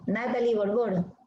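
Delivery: normal talking voice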